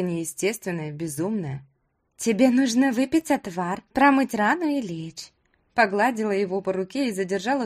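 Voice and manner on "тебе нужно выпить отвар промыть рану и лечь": put-on slightly raised, slowing young woman's voice; gently insistent girl, a little high; lightly voiced doting girl. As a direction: fuss over the patient like an adoring girl. Doting girl nurses the patient.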